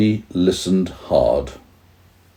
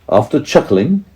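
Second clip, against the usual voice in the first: plain reading voice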